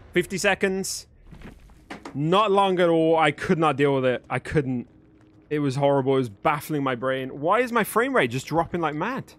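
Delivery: ordinary talk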